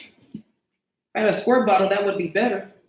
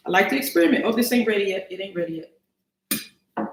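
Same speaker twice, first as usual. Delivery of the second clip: ordinary talk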